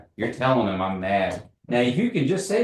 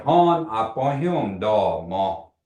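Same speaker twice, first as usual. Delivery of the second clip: slow model phrase; much slower than usual. Shown as a demonstration. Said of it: "I'm gonna say".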